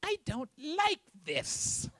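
put-on high, slow whining child voice; whiny kid. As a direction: drag it out like a picky kid refusing dinner. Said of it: "take one bite of it and go".

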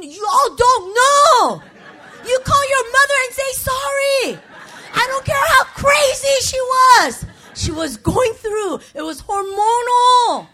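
fervent shouted appeal; preaching shout. Real, not acted. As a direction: drive it home to the crowd. Preaching at full force.

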